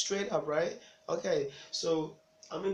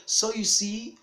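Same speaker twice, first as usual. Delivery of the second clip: raised, louder storytelling parent; mock parental lecture; moralizing parent; 8 semitones higher, 9 dB louder, slower than usual. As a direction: drive the moral home to a child, high and loud.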